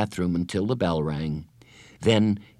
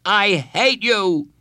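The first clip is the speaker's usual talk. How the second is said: put-on high, loud, drawn-out child's shout; angry shout; 12 semitones higher, 6 dB louder, much slower than usual. A narrator's reading. Boy shouts before running out, furious. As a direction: stretch it out and yell it. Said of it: "he shouted".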